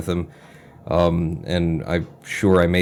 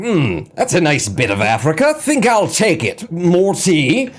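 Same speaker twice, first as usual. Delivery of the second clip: much higher, slow, plummy colonial toff; posh colonial Brit; 8 semitones higher, slower than usual. Pretend. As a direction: lazily claim it over tea. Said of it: "people seeing things and going".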